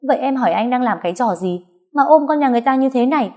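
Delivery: fast, high angry yell. Acted. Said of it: "Nó quát lên".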